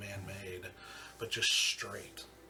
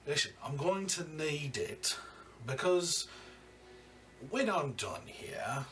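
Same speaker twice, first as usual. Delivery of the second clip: slow, high, louder threat with relish; menacing crime boss; 6 semitones higher, 4 dB louder, slower than usual. Acted. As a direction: savour every word of the threat.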